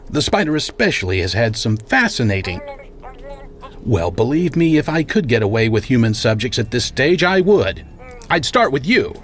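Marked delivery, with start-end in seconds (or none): 4.1-9.1 rising, louder, pointed retort; pointed threat. Acted. Boss telling caller he'd test on him, pointed. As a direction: needle the caller with relish.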